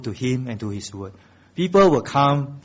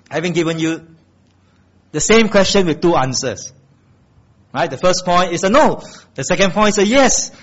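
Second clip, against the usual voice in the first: preaching at full force; 8 semitones higher, 6 dB louder than usual. Acted. Pastor rousing the church to hope, loud and high. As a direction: lift the congregation with every line.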